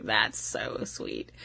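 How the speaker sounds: low, very soft, slow breathy sigh; hushed swoon. Retold or imitated. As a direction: sigh it out melting. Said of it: "I was like".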